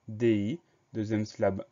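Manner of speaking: ordinary talk, nothing marked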